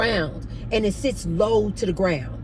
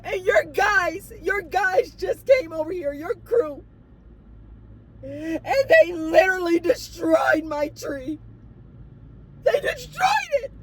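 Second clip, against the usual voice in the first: hammed-up hysterical sobbing, drawing out the words; frantic crying imitation; 10 semitones higher than usual. Resident telling manager her tree was destroyed, frantic crying. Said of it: "a woman crying frantic like this".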